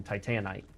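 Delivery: TITANITE said tie-TAN-ite; own pronunciation. Shown as a demonstration. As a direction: put the stress on TAN in Titanite. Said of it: "I should just keep saying".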